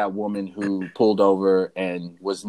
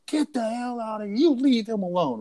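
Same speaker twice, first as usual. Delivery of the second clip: put-on much higher, fierce mom shooing the kids off; protective mom; 12 semitones higher than usual. Passing mother driving off the attackers, fierce mom voice. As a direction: chase off the bullies like an angry mother. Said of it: "she moms the hell out of it".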